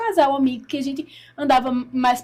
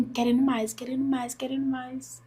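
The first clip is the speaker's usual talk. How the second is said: repeated phrase fading softer each time; hushed longing; 7 dB quieter than usual. Retold or imitated. Woman recalls hungering for more of God, fading longing. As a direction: let each repeat sink quieter.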